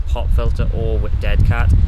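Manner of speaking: usual speaking voice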